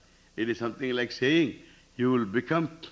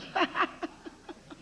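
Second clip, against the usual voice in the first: quiet laugh; 3 dB quieter than usual. Real, not acted. Laughs at the miracle demand.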